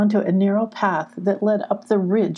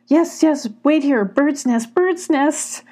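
eager repeating; 8 semitones higher, slower than usual. Acted. Misty repeating instructions to Sylvie, eager. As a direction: chant it back to remember. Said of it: "repeated Misty".

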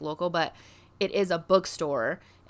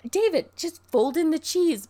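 Moira; 8 semitones higher, 5 dB louder than usual. Retold or imitated. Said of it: "She's like".

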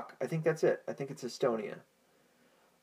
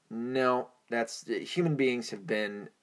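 usual speaking voice

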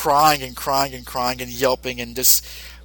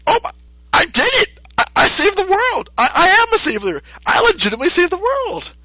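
much higher, louder triumphant cry; elated triumph; 4 dB louder than usual. Retold or imitated. Mister Satan celebrating his success, in a much higher, louder triumphant voice. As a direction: crow like you just saved the world. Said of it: "Mister Satan's like".

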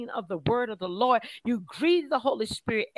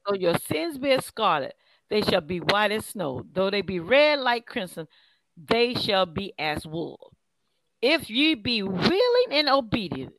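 measured, declaimed Bible verse; scripture reading; 2 semitones lower, 3 dB louder than usual. A narrator's reading. Minister reads Isaiah's promise to listeners, measured scripture reading. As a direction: read it out in deliberate phrases.

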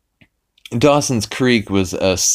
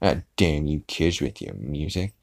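put-on softer, lower grumble at the kids; lightly voiced cranky old man; grumpy old man; 4 semitones lower, 7 dB quieter than usual. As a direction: mutter like a crotchety old man. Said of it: "he's like".

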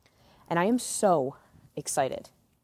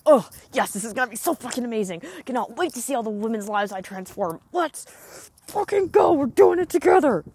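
mock hyped self-talk; 5 semitones higher than usual. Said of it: "It's like".

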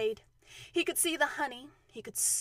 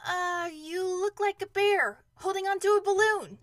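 high, loud, hesitant answer; calling up; 8 semitones higher, 7 dB louder than usual. Acted. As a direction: call it up to someone overhead. Boy calls up to Pooh.

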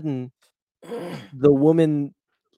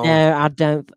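Southern accent; 2 semitones higher, 8 dB louder, slower than usual. Shown as a demonstration. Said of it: "that was kinda Southern".

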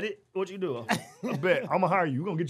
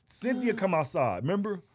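ordinary talk, nothing marked

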